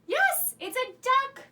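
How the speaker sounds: high, loud, drawn-out praise; excited confirmation. Pretend. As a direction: celebrate the right guess slowly and brightly. Cheers children's correct guess.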